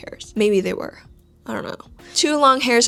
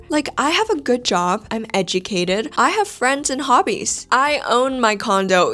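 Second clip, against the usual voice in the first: ordinary delivery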